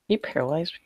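ordinary talk

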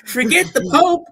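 high, loud, laughing mock defiance; laughing mock rant. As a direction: ham up the martyr's rant while cracking up.

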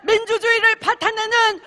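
rally chant, shouted high; roaring rallying speech. Real, not acted. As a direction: lead the crowd in a fiery chant. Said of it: "우리 한번 신나게 외쳐봅시다".

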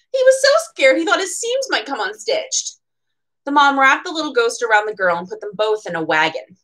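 usual speaking voice

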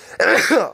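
sudden sharp cough; real cough. Real, not acted. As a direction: cough hard once, for real. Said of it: "o, przepraszam. Jestem trochę chory".

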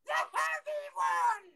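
very high, slow squeal; baby voice. Acted. Prank child answering the searchers, squealing baby voice. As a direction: whine it out like a squashed toddler. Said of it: "The, the baby voice".

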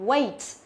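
"Wait" with full ay glide, falling; teacher's careful "Wait", gliding vowel; correct model. Shown as a demonstration. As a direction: model "Wait" clearly with long A. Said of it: "Không phải là wait".

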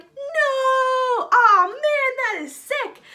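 very loud, high shout; thrilled crowd. Acted. Crowd hearing of the resurrection, loud excited shout. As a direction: yell with amazement. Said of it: "everyone's like".

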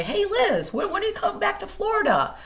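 cheerful pleading; eager fans. Retold or imitated. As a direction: friends begging her to come back, high and bright. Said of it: "email me and say".

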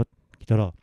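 plain reading voice

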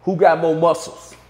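loud mocking dare; Suge challenging. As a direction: imitate Suge taunting the room. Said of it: "Suge go".

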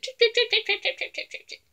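high, fading trip trip; quick hoofsteps. A narrator's reading. Narrator sounding the little goat fleeing the bridge, high and fading. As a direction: patter away like fleeing hooves.

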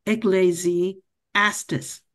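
Ecclesiastes syllable by syllable, very slow; teacher's deliberate model; slow model reading. Shown as a demonstration. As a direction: say Ecclesiastes slowly for students to copy. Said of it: "estes, estes".

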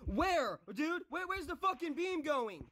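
high-pitched angry questioning; exasperated outburst. Real, not acted. Player asking where the beam goes, exasperated outburst.

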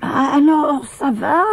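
high voice asking eagerly; old Maya prying gently about the tooth; curious old woman. A narrator's reading. Maya asks Lina about her tooth, curious and high. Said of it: "demanda la vieille Maya avec curiosité".

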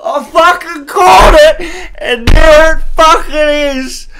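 very high, loud triumphant shouting; ecstatic yelling. Real, not acted. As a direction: scream with disbelief and joy. Called the drop, yelling.

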